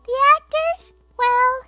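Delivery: put-on high, bright-eyed scientist girl; high character voice. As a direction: lift the voice way up for Washu. Washu answers the nervous director.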